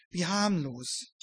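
ordinary talk

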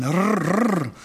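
rolled rrr like a starter that won't catch; imitated engine sputter. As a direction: mimic a dead motor cranking. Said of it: "berichtet das so schön".

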